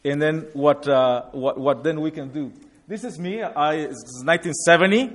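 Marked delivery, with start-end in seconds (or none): none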